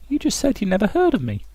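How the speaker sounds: very high falsetto retort; artist character. Acted. Artist challenging a farmer's question, in high falsetto. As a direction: answer back in a high put-on voice.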